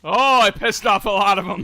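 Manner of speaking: loud, high excited outburst; excited shout. Real, not acted. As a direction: blurt it out thrilled. Laughing at chaos he caused.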